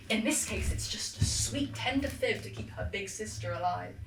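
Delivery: usual speaking voice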